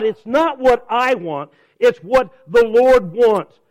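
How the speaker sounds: ordinary talk, nothing marked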